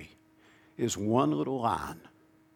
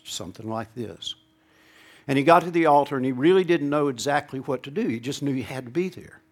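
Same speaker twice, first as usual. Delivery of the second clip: ordinary talk, nothing marked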